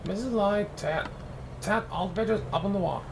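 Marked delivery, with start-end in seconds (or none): none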